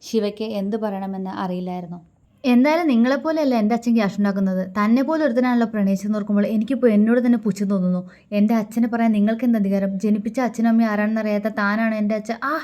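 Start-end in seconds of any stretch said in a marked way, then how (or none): 2.4-12.5 louder, fast furious rant; wife lashing out at husband; heated tirade. A narrator's reading. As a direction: pour out the scorn. Heated quarrel, insulting him.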